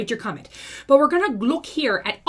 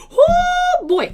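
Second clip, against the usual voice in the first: breathless gasp; 7 dB louder than usual. Real, not acted. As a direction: gasp it out, winded. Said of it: "I'm out of breath".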